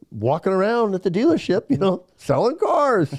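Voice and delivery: high-pitched, playful singsong answer, stretching the last words; upbeat retold reply. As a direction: toss it out brightly, pitch climbing to the end. Retelling his cheerful answer to Mike.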